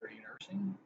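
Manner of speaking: breathy joking whisper; a sly whispered aside to a friend; teasing whisper. Real, not acted. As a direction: whisper it like a private joke. Teasing question about holding ball.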